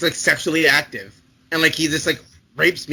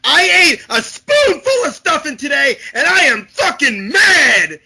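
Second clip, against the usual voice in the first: hammed-up shout, slow, louder and higher; mock furious shout; 9 semitones higher, 7 dB louder, slower than usual. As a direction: yell it like a crazed stuffing eater. Acted rage of stuffing eater.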